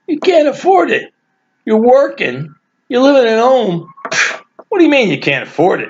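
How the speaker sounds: high, loud mock disbelief at an excuse; incredulous. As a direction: raise the pitch, you don't buy it for a second.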